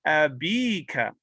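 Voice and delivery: abika with a slow, long ee; long ya vowel. Shown as a demonstration. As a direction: stretch the bi in abika. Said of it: "were majroor".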